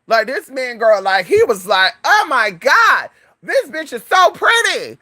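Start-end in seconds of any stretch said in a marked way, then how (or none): 2.0-5.0 high, drawn-out mock gushing; gushing admiration. A guy admiring a stranger's looks, high slow gushing. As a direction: imitate a man swooning, stretching the words up high.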